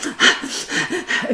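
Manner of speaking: high, breathy burst of laughter. Retold or imitated. Reader reacting to water thrown in the face, laughing. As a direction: laugh in disbelief.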